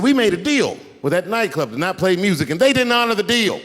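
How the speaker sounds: high, insistent retold protest; indignant producer. Producer explaining broken deal with nightclub, indignant.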